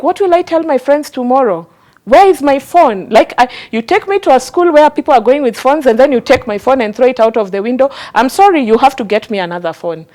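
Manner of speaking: high, loud imitation of an upset teenager; upset teenage daughter. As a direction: play the outraged daughter protesting over her lost phone. Daughter's upset retold protest.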